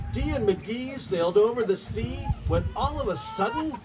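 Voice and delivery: lively rhyming storybook read-aloud; reading to children. Acted. Author reading her picture book to children, lively read-aloud. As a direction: bounce the rhymes for a room of kids.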